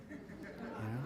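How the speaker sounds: ordinary talk, nothing marked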